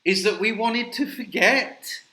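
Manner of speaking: loud, rising mock amazement; mocking incredulity. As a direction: build to a high, sarcastic exclamation. Mocking echo of the clip's claim.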